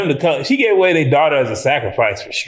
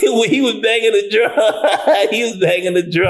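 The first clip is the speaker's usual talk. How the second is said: higher voice breaking into real laughter; laughing; 7 semitones higher than usual. Real, not acted. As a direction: tell it while cracking up. Cracking up over the cameo.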